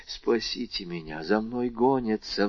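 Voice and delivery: soft, begging plea; hushed desperate begging; pleading. A narrator's reading. Hunted thief begging the women for help, soft pleading. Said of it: "взмолился Андреа".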